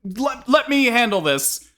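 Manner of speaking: high, loud flustered babble; a flustered customer babbling at the staff. Acted. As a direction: babble frantically, losing the thread.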